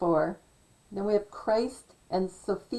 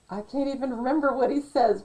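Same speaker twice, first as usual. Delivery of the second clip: fast, louder put-on DJ voice; funny DJ voice; 4 semitones higher, 4 dB louder, faster than usual. Shown as a demonstration. Still mimicking the Yacht Rock DJ, fast and put-on. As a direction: keep the silly DJ voice going. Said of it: "he talks like this".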